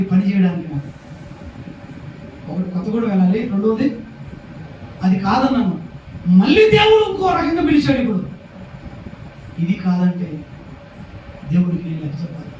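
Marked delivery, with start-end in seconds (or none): 6.3-8.0 high, raised preacher's cry; impassioned preaching. Real, not acted. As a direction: ring it out to the congregation. Preacher testifying to God's call. (ఉద్వేగంతో గొంతెత్తిన బోధ)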